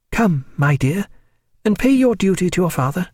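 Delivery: gently coaxing mother. A narrator's reading. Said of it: "taking her gently by the hand".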